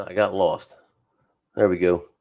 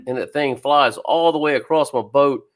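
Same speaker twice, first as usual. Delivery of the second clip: ordinary talk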